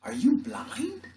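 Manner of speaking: high, slow, astonished question; stunned, drawn-out question; shocked question. Acted. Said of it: "He gasped".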